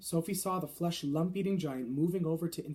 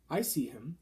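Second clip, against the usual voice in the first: ordinary delivery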